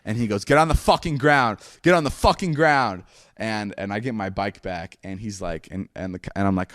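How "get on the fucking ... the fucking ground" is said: raised, higher retold police order; angry cop command. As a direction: imitate a cop ordering someone down.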